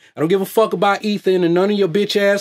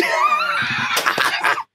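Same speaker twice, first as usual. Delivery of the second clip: shrieking laugh; 4 dB quieter than usual. Real, not acted. Laughing wildly at own joke.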